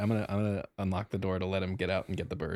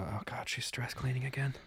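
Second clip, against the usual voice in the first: whispered aside to himself; 4 semitones higher, 6 dB quieter than usual. Acted. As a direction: mutter it under your breath, worried. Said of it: "I'm gonna hear the things and go".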